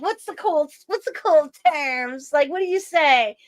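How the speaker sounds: imitating an out-of-touch mom's friend. Daughter mimics her mom's friends asking for slang. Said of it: "my mom's friends would be like".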